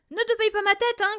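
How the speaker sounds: loud, high angry shout. A narrator's reading. Father shouts at his daughter, loud high shout. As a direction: shout him down. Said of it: "cria le père".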